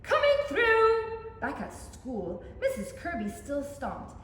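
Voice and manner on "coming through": loud, high, slow call. Acted. Someone rushing through the schoolyard, loud slow call. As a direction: clear the way.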